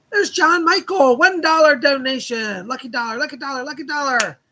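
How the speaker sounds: loud, high donation shout-out; excited announcement. Real, not acted. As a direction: call it out gleefully to the stream. Calling out a donation on livestream.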